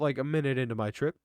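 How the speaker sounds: usual speaking voice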